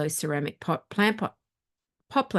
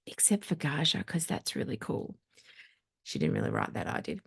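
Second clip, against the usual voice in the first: quiet aside; 3 semitones lower, 8 dB quieter than usual. Real, not acted. Joking aside about herself.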